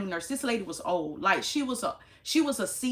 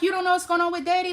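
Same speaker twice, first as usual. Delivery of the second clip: higher, louder, fast disbelief; can't believe they don't know; incredulous question; 9 semitones higher, 6 dB louder, faster than usual. Retold or imitated. To the clueless nurses, incredulous and fast.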